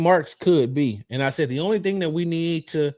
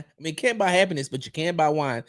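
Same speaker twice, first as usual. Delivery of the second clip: usual speaking voice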